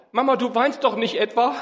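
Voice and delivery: put-on high, pleading child's sob; a hint of a crying little boy; sobbing child. A narrator's reading. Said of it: "schluchzte er".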